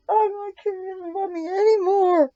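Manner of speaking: high, hammed-up whimpering; mock crying. Owner pretending the cat broke her heart, mock sob. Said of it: "I'm gonna cry".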